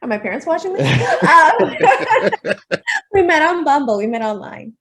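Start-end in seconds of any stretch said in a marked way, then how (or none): 0.5-4.0 giggling through an embarrassed confession; laughing. Real, not acted. Laughing as she admits to the host they met on Bumble. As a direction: laugh it out, a bit sheepish.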